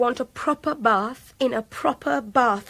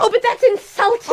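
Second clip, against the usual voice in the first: indignant protest; 9 semitones higher, 9 dB louder than usual. Acted. Captive protests being tied.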